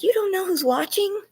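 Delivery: high, incredulous quoted friend; a lightly mocking warning; mock worried friend. Friend warning about the address, voiced high. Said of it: "She's like".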